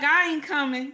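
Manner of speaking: put-on a sulking little girl refusing her husband; childish refusal. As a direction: sulk like a little kid. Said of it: "how a little kid do".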